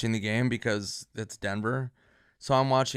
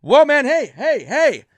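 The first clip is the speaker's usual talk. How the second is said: much higher, loud calls of worry; alarmed bar crowd; 13 semitones higher, 16 dB louder, slower than usual. Retold or imitated. To the podcast host, acting the bar crowd, high, loud alarm. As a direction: shout it like strangers rushing over to help. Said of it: "people were like".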